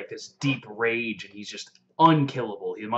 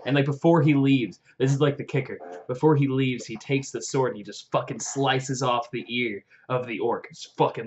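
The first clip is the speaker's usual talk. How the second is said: ordinary delivery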